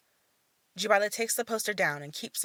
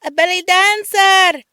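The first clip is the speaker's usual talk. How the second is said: put-on high, loud, slow child's shout; a young boy crying out; 12 semitones higher, 17 dB louder than usual. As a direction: shout it from the window.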